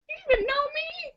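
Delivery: put-on very high, soft, quick old-lady squeak; the put-out grandma asks it meekly; soft grandma protest. Grandma answers the grandchild, high and soft.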